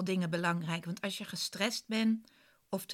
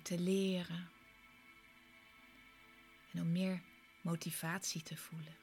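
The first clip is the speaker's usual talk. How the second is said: guided meditation. Acted. Leads a guided relaxation.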